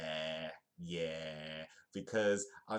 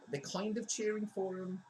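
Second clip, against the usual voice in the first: high falsetto commentary; mock excitement; 13 semitones higher than usual. Streamer commenting on the crowd's cheers, high mock excitement. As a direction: squeak it out in put-on excitement.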